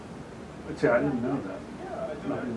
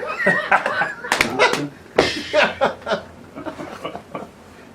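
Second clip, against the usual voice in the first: long, high burst of laughter; helpless high laughing fit; real laughter; 14 semitones higher, 4 dB louder than usual. Real, not acted. Friend cracking up at a rude-sounding surname joke, laughter.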